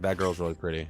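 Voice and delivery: put-on low, soft drunk slur; a tipsy guy mumbling. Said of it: "I think I was drunk".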